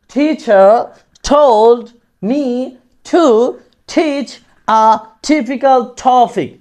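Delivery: each word set apart slowly. Shown as a demonstration. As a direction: lay down every word on its own. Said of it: "এরকমভাবে উচ্চারণ করার চেষ্টা করব". (শব্দ ধরে ধরে ধীরে)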